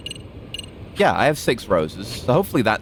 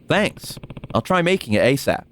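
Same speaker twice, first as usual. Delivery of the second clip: ordinary delivery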